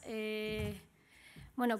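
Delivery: ordinary delivery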